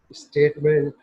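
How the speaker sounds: ordinary talk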